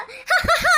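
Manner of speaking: high eerie mock cackle; ghost's laugh. Girl acts out the ghost's laugh for her family, high cackle. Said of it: "എന്ന് പറഞ്ഞിട്ട് ചിരിക്കുക കൂടി ചെയ്തു".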